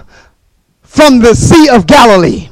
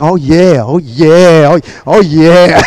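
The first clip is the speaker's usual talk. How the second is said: low, laid-back oh-yeahs; cool priest; 7 semitones lower, slower than usual. Retold or imitated. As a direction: croon it low like the cool one. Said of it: "the one that was cool".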